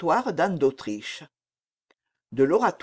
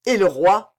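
louder, pointed demand; menacing tone; 3 semitones higher, 7 dB louder than usual. A narrator's reading. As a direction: ask with an edge of threat. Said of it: "d'un ton qui fit trembler la reine".

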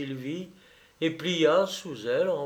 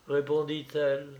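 usual speaking voice

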